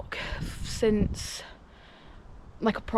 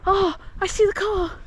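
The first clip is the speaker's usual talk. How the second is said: excited; 10 semitones higher, 3 dB louder than usual. Real, not acted. Relieved at reaching the car.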